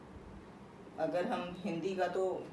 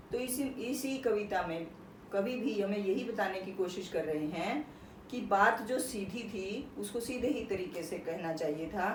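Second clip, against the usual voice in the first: usual speaking voice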